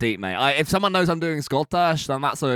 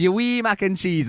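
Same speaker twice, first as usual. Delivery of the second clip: higher, lilting Scottish echo; sweet Scottish waitress; 7 semitones higher than usual. Retold or imitated. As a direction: recall the waitress's sweet Glasgow voice. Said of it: "Scottish can sound very innocent and sweet".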